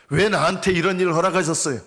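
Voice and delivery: protesting why me in prayer; resentful complaint. Acted. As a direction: throw the blame up at God. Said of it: "하나님을 원망하는 거예요".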